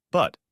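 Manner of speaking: "But" given extra weight and held a beat; a deliberate stressed "But"; emphasis on But. Retold or imitated. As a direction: lean on the word "But" before going on. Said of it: "and I put emphasis on that word".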